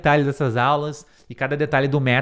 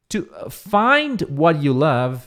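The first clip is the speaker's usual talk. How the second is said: slow model phrase; much slower than usual. Shown as a demonstration. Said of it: "model phrase".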